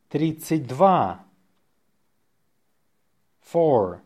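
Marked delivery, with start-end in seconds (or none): 0.1-1.2 natural-speed dictation; faster. Shown as a demonstration. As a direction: say it briskly, in one go.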